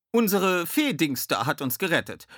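high, delighted voice; beaming joy. A narrator's reading. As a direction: gush happily about your rescuer. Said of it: "mischte sich Sally freudestrahlend ein".

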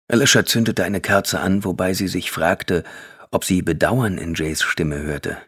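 plain reading voice

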